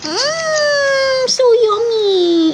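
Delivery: high, drawn-out gushing coo. Real, not acted. Playfully gobbling up her cute child. (matinis at nanggigigil na lambing)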